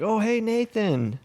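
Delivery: high slow fan greeting; drawn-out eager hello; imitating a fan. Friend mimics fan greeting.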